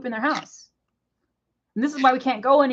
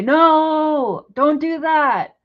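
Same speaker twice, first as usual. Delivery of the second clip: imitating her yell; 5 semitones higher, 4 dB louder, much slower than usual. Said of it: "When I yell and I scream and I get mad".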